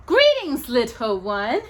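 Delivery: high, loud mock-warm witch greeting; warmly. A narrator's reading. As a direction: greet her with false warmth. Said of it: "Winifred said warmly".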